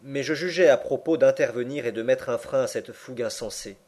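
ordinary delivery, nothing marked

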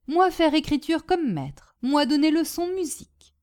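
lightly voiced foreigner in broken French; Boginski, cheerful Polish storyteller. Polish guest telling his hostess of boots and battle, cheerful. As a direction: tell your war tale eagerly, phrase by phrase.

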